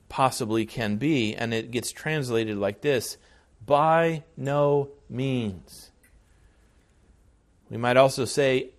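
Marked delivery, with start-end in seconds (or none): 3.6-5.9 "By no means" said very slowly, each word weighed; emphatic. Shown as a demonstration. Preacher giving Paul's emphatic answer very slowly. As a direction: stretch out "By no means" with slow, heavy weight. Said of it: "makes his answer as emphatic".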